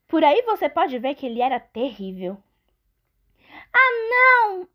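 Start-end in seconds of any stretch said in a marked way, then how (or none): 3.7-4.7 very high, loud alarmed goat; little goat voice. Acted. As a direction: gasp it like a frightened kid goat. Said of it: "disse o pequeno bode da montanha".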